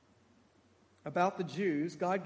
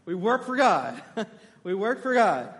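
laughing; 8 dB louder than usual. Real, not acted. Laughing at the obvious answer.

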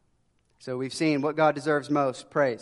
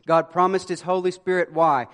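ordinary delivery

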